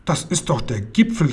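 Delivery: understated stern exclamation. A narrator's reading. Old lady scolds the uncle, mildly stern. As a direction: protest with a touch of severity. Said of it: "rief die alte Dame streng".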